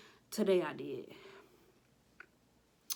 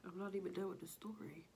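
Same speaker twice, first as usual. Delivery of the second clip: breathless whisper; 3 semitones higher, 7 dB quieter, faster than usual. Retold or imitated. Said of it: "Do you really wanna hear the story?".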